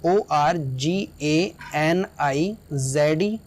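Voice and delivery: very slow letter-by-letter spelling of ओआरजीएएनआईजेडई. Shown as a demonstration. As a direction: spell ओआरजीएएनआईजेडई out slowly, one letter at a time. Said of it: "जैसे". (बहुत धीरे एक-एक अक्षर बोलकर spelling)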